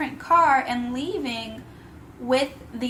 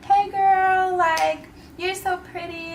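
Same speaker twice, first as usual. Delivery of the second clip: a lightly voiced sweet girl, higher, starting louder; sweet assistant voice; 6 semitones higher, 3 dB louder, slower than usual. The assistant complimenting her: lightly imitated sweet gush. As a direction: gush it like a friendly stranger, pitched up. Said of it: "She was like".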